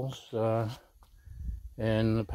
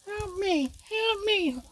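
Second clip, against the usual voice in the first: very high, tiny mock cry for help; squeaky pleading cry; slower than usual. Cook voicing the pizza as a very high mock cry for help. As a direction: squeak it like the pizza begging to be saved.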